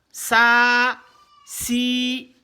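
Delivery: "ça" and "si" each held long; sustained "ça", then sustained high "si"; drawn-out vowels. Shown as a demonstration. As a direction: stretch the vowels of "ça" and "si" on a level note. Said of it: "si on veut tirer".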